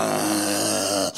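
long, low, quiet groan; bored yawning groan. Retold or imitated. Son unmoved while everyone laughs at dad's jokes, bored groan. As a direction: imitate a bored teenager's drawn-out groan. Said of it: "He's yawning".

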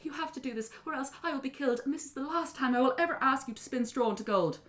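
high, desperate begging; acted girl in despair; pleading young woman. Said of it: "young woman said".

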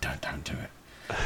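mock-pleading whisper; slow, hushed playful warning; whispered plea. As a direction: beg under your breath, very softly. Mock plea not to tell.

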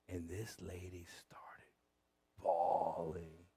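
slow, near-whispered telling; hushed. Real, not acted. Preacher telling how this lady started bawling, slow hushed voice. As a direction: let it drop to a slow, soft hush.

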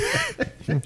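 high squeaky giggle; quick high laugh before the bit; giggle burst. Real, not acted.

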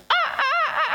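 high crowing cock-a-doodle; rooster impression. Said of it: "That's my best rooster impression".

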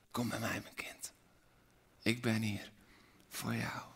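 soft whisper of Jesus calling; whispered invitation. Acted. Jesus inviting his child, whispered. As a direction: breathe it like a tender invitation. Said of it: "En hij zegt vandaag".

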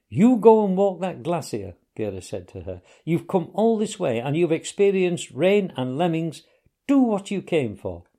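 loud, high and insistent; urging. A narrator's reading. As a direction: push her to go, full voice. Gerda urges the Englishwoman on.

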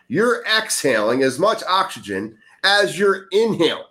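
ordinary talk

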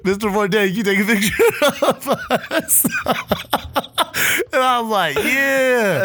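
laughing. Real, not acted. Laughing retelling celebrity photo.

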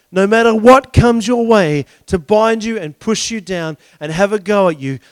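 loud, driving preacher's build; forceful preaching. Acted. Preacher rallying against what binds you, forceful preaching. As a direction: drive the list home with force.

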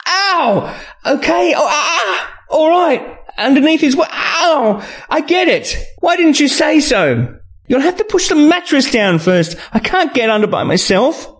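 high yelps turning to exasperated complaint; pained female character. A narrator's reading. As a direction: yelp and protest, pitched far up. Woman cries out, then complains.

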